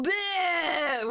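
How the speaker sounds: a short high mock gag of disgust. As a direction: groan it out like you can't stand the sight. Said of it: "Michael and I go".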